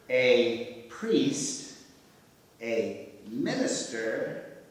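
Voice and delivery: very slow, word-group by word-group delivery; slow joke setup. Acted. Writer trying out a car wash joke, slow and deliberate. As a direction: lay out each piece of the setup separately.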